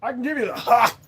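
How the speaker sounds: slow, high, hammed-up Southern drawl; exaggerated Southern drawl; Southern accent. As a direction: drawl it out like a good ol' boy. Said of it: "different Southern accents".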